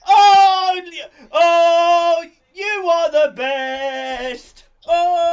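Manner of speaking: overjoyed yelling, slow on you are the best; excited shouting. Real, not acted. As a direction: shout with delight. Viewer count hits new record.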